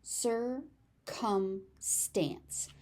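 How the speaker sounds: circumstance said very slowly, "cir... cum... stance", each syllable apart; broken into syllables. Shown as a demonstration. Teacher showing learners the word circumstance, slowly syllable by syllable. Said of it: "pronounced two different ways".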